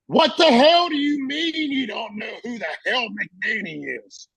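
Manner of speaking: high, drawn-out mock yell of outrage; imitated screaming. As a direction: yell it slowly like an incensed superior. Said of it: "just screaming".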